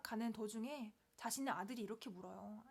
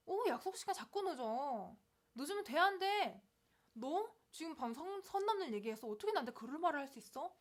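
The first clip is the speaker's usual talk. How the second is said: acted indignant telling-off of a friend; harsh scolding; 5 semitones higher, 3 dB louder than usual. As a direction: scold a friend for breaking your rules. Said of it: "막 나쁘게 말할 수 있겠죠". (따지듯 나무라는 말투)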